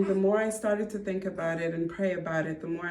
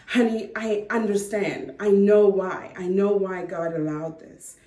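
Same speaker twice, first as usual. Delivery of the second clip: retold breakthrough, louder and emphatic; excited realization; 3 semitones higher, 8 dB louder than usual. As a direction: announce a sudden understanding, loudly. Retells sudden realization to husband.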